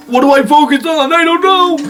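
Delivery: high-pitched frantic mock voice; mock confusion. Camera asking what to focus on, mock panic. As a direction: squeal it in comic confusion.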